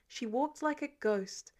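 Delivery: ordinary delivery, nothing marked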